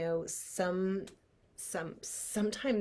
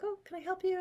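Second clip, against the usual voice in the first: a high, chirpy mock receptionist; imitating receptionist; 12 semitones higher, 3 dB quieter, faster than usual. Receptionist greeting her after the phone call, mock high. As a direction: lift the voice into a mock front-desk lilt. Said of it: "She was like".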